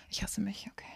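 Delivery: self-mocking whisper. Real, not acted. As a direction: whisper it in mock self-disgust. (selbstironisch geflüstert)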